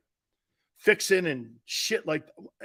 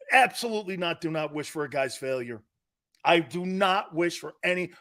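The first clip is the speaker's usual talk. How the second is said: ordinary talk, nothing marked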